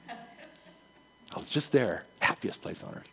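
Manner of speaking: slow, murmured aside. Real, not acted. Pastor's quiet aside about a trip to Disney, murmured. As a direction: say it half to yourself.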